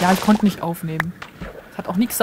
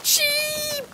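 long, very high held call; 3 dB quieter than usual. Pretend. Player spotting a sheep at last, high drawn-out call. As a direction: sing out the word in a high coaxing note.

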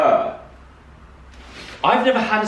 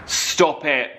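normal talking voice

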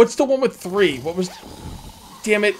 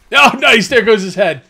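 loud, higher-pitched whoop of approval; excited cheering; 4 semitones higher, 8 dB louder, slower than usual. Real, not acted. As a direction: cheer on a teammate's kill. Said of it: "I was cheering you on".

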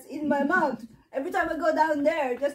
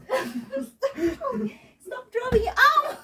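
high squeal through laughter; helpless giggly yell, rising loud at the end; laughing protest; 7 semitones higher, 5 dB louder, slower than usual. Real, not acted. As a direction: beg them to stop while cracking up. Mother pelted, laughing.

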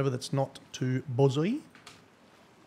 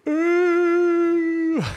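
loud, high, held-out whoop; piercing drawn-out ooh; 10 dB louder than usual. Real, not acted. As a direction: let out a long high "ooh!". Reacts to a big donation.

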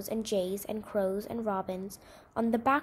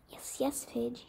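hushed, almost breathless soothing; very soft; 8 dB quieter, slower than usual. A narrator's reading. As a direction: murmur it gently to calm a hurt bird. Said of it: "he said very softly".

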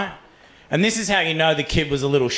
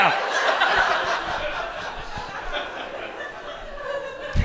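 high, silent wheezing laugh; breathless silent laughter; 6 dB quieter than usual. Real, not acted. Cracking up.

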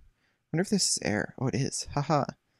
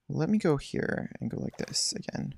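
usual speaking voice

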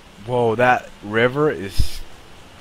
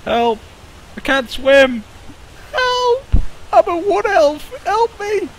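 high panicked cries for help; terrified horror victim; 10 semitones higher, slower than usual. Acted. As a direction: scream like you're drowning. Voicing drowning elf in game.

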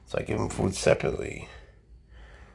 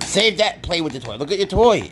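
excited pet talk; 10 semitones higher, 6 dB louder, much slower than usual. Real, not acted. Owner luring the cat to a toy snake, high excited baby talk. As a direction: get the cat hyped up.

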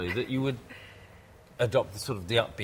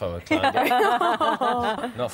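laughing; 12 semitones higher, 4 dB louder, much slower than usual. Real, not acted. Self-mocking joke, laughing.

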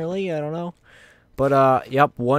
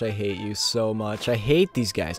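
usual speaking voice

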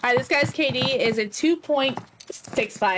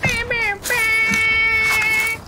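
high, loud bam calls; triumphant shout; 14 semitones higher, 5 dB louder, much slower than usual. Real, not acted. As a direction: punch each bam. Bingo celebration.

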